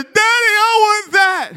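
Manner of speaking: put-on high, loud, slow child's shout; excited child. Son spotting the biggest T-Rex, loud excited child. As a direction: shout it like an excited five-year-old. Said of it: "He said".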